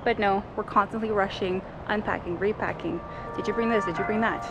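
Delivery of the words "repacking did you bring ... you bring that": ordinary talk, nothing marked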